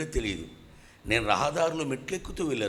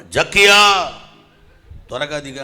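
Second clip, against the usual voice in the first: calling out; 14 dB louder than usual. Retold or imitated. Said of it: "అన్నాడు యేసన్న గారు".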